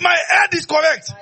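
high loud shout; shouted declaration. Shown as a demonstration. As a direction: shout it out with the congregation. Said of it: "Hey, say it".

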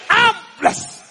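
very high, exultant faith confession; ringing high-pitched preacher's cry; shouted declaration. Real, not acted. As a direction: proclaim it high for the crowd to repeat. Leads congregation in shouted declaration.

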